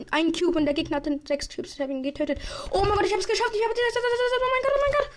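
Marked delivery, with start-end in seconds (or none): 2.7-5.1 high, loud ecstatic cries; shrieking jackpot winner. Real, not acted. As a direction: scream with disbelieving joy. Just won the round.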